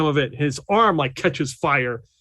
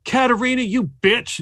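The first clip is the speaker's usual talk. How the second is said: raised, shouting insult; mock angry yell; 9 semitones higher than usual. Woman wrestling Lou turning on Katerina, raised angry yell. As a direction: yell it at your rival. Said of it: "is like".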